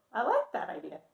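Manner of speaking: ordinary delivery, nothing marked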